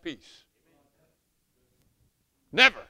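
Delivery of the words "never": one loud shouted "Never". Real, not acted. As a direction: hammer "Never" home loudly. Preaching emphasis.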